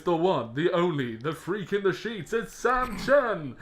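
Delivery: loud, high, slow hype announcer; ring announcer. Retold or imitated. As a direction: stretch it out like a boxing MC. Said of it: "the announcers".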